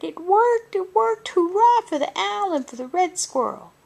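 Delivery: joyful rabbit cheer. Acted. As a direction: celebrate like kids who just won. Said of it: "They ran all around and cheered".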